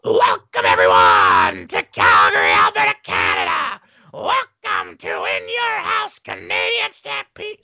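huge strained announcer shout, words dragged out; Vince McMahon screaming. Retold or imitated. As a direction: bellow a drawn-out, over-the-top show opening. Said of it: "and Vince screaming".

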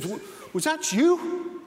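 a high, slow, stammering "was that you?" with a nervous laugh in it; a stunned thief, half laughing in disbelief; startled stammer. Acted. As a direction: squeak it out like a startled burglar. Startled burglar sees the parrot.

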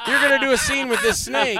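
high, loud imitation of a pushy promoter; retold announcement. Promoter announces the snake scene.

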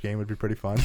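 usual speaking voice

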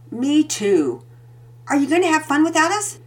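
put-on playful little boy asking; young boy. A narrator's reading. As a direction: kid teasing his mom. Son voiced in story.

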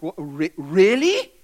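incredulous, rising sharply on really; surprised disbelief. Retold or imitated. Churchgoers learning Jesus is not English, incredulous. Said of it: "we got like".

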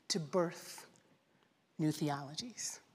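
a near whisper for the last words; hushed whisper. Real, not acted. Preacher hushes the congregation to a whisper. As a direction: breathe it out, almost silent.

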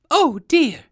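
high, loud cry of dismay; exclaiming. A narrator's reading. Hidden voice from the bushes, exclaiming. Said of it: "and a voice exclaimed".

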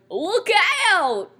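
high, loud cartoon warning holler; Goofy. Co-host imitating Goofy's battle call, mock cartoon holler. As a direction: yell it out like a goofy cartoon character. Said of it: "it sounded like Goofy going".

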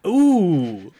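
high, loud drawn-out ooh; excited ooh. Real, not acted. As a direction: burst out with a delighted ooh. Sudden delight at horror sequel idea.

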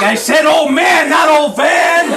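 slow, high, loud yell; exasperated yell at someone hard of hearing; exasperated shout. Acted. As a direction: shout the correction, stretching each word. Pianist yells at genie's mishearing.

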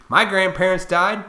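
ordinary delivery

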